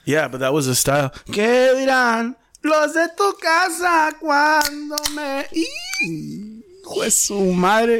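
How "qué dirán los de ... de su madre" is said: high, slow falsetto character; high put-on voice.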